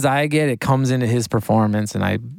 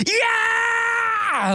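a huge, much higher scream of hype; pumped scream. Retold or imitated. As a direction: yell it like the take just blew you away. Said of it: "I'm screaming just as pumped as he is".

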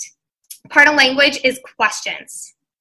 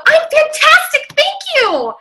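quick, staccato, much higher pitch; high energy; 12 semitones higher than usual. Acted. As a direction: burst out upbeat and high, punching each word. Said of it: "Quick, staccato, loud".

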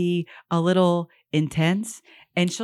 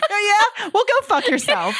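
put-on high sassy child voice; bratty kid voice; 12 semitones higher than usual.

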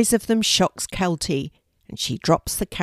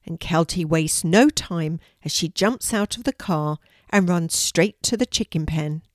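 ordinary delivery, nothing marked

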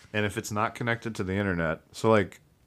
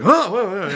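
high, loud jolted gasps; startled gasps; 11 semitones higher, 10 dB louder than usual. Retold or imitated. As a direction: jerk awake in alarm. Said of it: "is just like".

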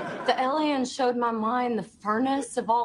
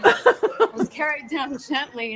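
trying to hold back laughter mid-line; corpsing through the line; breaking into laughter; 4 semitones higher, 5 dB louder than usual. Real, not acted. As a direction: voice cracking from laughing. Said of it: "He's crying".

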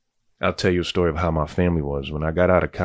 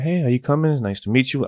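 imitating the cousin with a much higher, sweet greeting; sweet girl voice; 9 semitones higher than usual.

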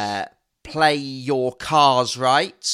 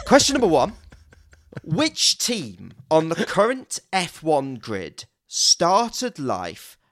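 quiz host; 4 semitones higher than usual. Acted. Reading quiz questions.

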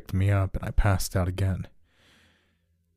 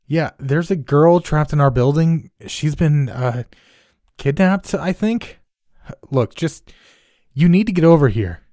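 high, loud, urgent plea; urgent phone call; 8 semitones higher, 7 dB louder than usual. Acted. Neighbor on the phone to the police, urgent and loud. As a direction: push the cops to hurry.

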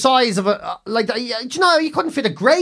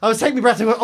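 ordinary talk, nothing marked

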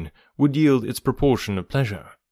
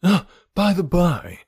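high bright exclamation, a touch slower; gleeful cry, pitched up; cry of sudden glee; 8 semitones higher, slower than usual. A narrator's reading. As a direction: burst out as a thought strikes. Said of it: "he cried".

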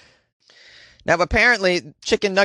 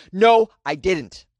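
usual speaking voice

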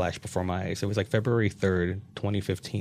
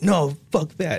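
higher-pitched, worked-up refusal; retold anger; 7 semitones higher, 4 dB louder, slower than usual. Acting out refusing partner's suggestion before slamming the door, mock angry. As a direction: imitate yourself angrily refusing. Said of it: "I was really angry".

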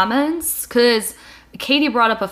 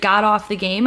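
normal talking voice

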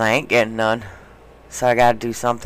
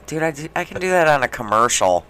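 ordinary delivery

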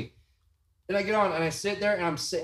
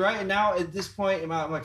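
normal talking voice

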